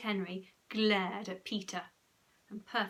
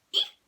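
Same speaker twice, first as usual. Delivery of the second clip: high squeak; 8 dB louder than usual. A narrator's reading. Getting into icy bath.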